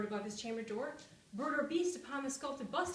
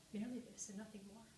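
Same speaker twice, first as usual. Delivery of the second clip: usual speaking voice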